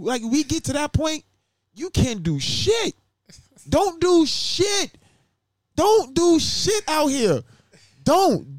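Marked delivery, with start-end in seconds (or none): 3.7-8.6 emphatic warning. Retold or imitated. Imitating strict warning.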